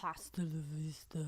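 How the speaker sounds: low, quiet movie-robot sign-off; Terminator catchphrase. Pretend. Signing off the show, low robotic Terminator line. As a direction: deliver it like the Terminator.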